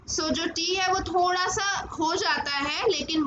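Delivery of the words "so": ordinary talk, nothing marked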